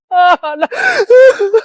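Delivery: loud, high anguished wail; wailing sob. Acted. Mother wails over her dead child, loud sobbing. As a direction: break down sobbing.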